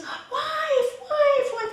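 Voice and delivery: high, loud desperate calls; calling out for wife. Acted. Husband calling his wife in the flood, desperate shouts. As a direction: cry out for her as she is swept away. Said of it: "And he goes".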